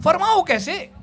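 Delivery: loud, high-pitched objection; mock indignant challenge. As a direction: challenge the word as if affronted. Mock objection to the lyric's wording.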